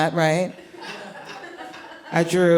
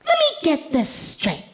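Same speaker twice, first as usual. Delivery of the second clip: put-on little girl voice; 4 semitones higher than usual. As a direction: lay it out like a serious toddler. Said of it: "she says to me".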